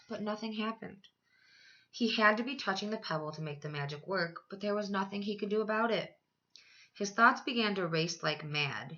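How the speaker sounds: plain reading voice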